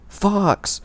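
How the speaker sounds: high shout of a single name. Acted. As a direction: yell it across the battlefield. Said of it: "was the last thing I heard".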